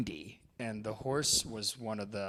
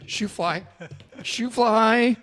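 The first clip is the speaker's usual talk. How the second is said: urgent calling; 11 semitones higher, 10 dB louder, much slower than usual. Retold or imitated. Calling the dying horse.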